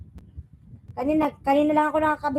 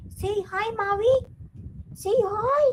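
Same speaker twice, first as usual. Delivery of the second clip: high coaxing voice to the pet; pet talk; 7 semitones higher, slower than usual. Real, not acted. Host coaxing her pet to greet viewers, high sing-song. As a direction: coax the pet to greet, pitched up.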